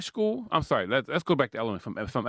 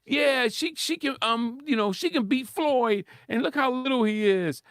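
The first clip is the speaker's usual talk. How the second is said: excited, raised-pitch mock cheerleading; imitated hype men; 8 semitones higher than usual. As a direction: mimic men egging a fighter on. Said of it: "all the men got behind her, was like".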